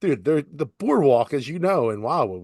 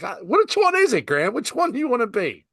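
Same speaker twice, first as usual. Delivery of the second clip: mock exasperated kid; 12 semitones higher than usual. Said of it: "I'm like".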